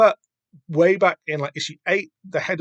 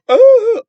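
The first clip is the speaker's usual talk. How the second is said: a sudden, very high, loud "Oh" as he keels over; mock faint cry; 14 dB louder than usual. Acting out Boy passing out, high and loud mock cry. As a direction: squeal it like someone fainting.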